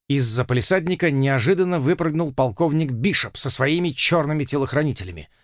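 ordinary delivery, nothing marked

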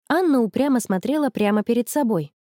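ordinary delivery

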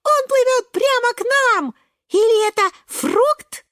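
very high, excited squealing; astonished shout. Acted. Шпинатик spotting something huge, very high excited cry. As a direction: squeal the discovery to everyone. Huge creature swimming toward them.

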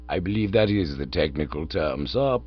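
low, smooth valet voice; Jeeves the butler. A narrator's reading. Butler Jeeves informing Bertie of a note, low and deferential.

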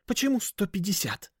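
high, hissy challenge; sharp suspicious question; demanding. A narrator's reading. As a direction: demand an explanation in a high hushed voice. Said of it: "требовательно спросило существо".

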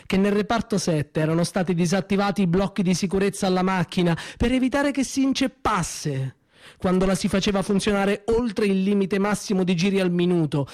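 ordinary talk, nothing marked

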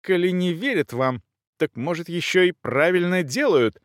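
high-pitched offended comeback; affronted lady's high retort; indignant retort. A narrator's reading. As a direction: throw it back at the rival, voice high. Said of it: "воскликнула Элеонора Августа".

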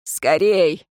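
short, curt urging; one brisk, cut-off word; abrupt order. A narrator's reading. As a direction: say it abruptly, as a command. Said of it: "отрывисто произнёс".

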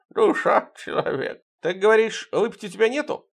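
put-on high lisping drunk friend; high Fima voice. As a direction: squeak like the beaten drunk.

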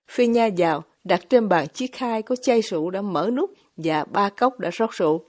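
plain reading voice

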